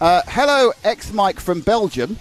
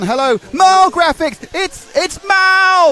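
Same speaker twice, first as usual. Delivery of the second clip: drawn-out excited greeting; 9 semitones higher, 4 dB louder, much slower than usual. Acted. Greeting a chat fan playfully.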